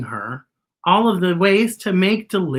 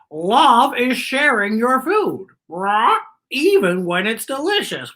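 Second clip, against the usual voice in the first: cawing crow; 6 semitones higher than usual. Acted. Crow explaining love as sharing food, high and squawky. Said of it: "And the crow says".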